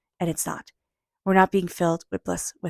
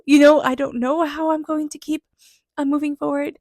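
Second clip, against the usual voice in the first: choked up, words pushing out higher; getting emotional; 8 semitones higher, 7 dB louder than usual. Real, not acted. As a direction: voice rising high and loud with emotion. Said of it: "I'm getting emotional here".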